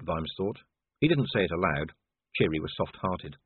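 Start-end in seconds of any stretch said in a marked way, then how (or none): none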